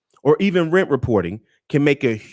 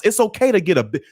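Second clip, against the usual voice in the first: ordinary talk, nothing marked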